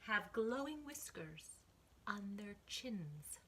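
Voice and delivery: put-on lively read-aloud for kids, whispering the spooky bits; picture book rhyme, gently performed; story reading. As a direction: read to children, dropping to a hush for the mysteries.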